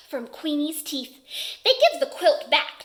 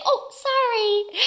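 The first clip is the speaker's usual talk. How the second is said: acted apology; 8 semitones higher, 4 dB louder than usual. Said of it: "And then I say".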